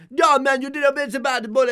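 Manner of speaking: put-on loud, fast Jamaican accent. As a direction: shout it like arguing Jamaicans. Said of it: "they would just be like".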